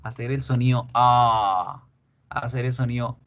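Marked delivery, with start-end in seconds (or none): 0.9-1.8 "A" held long as aah; lengthened vowel. Shown as a demonstration. Teacher showing vowel before R, "A" drawn out. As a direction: stretch "A" into an open aah. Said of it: "el hecho de alargar la vocal".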